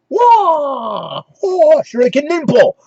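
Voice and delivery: high, drawn-out martial-arts cries; hammed-up ninja battle yell; cartoon ninja attack shout; Winter Ninja. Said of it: "it's the Winter Ninja".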